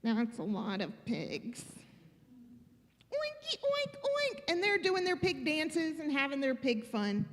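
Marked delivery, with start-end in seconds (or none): 0.0-1.7 low, quiet aside; Gerald thinking aloud. Acted. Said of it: "Gerald is thinking". 3.1-4.4 high, soft little oinks; squeaky-high piggy oinks, kept small; pigs oinking. Acted. Pigs oink as they dance.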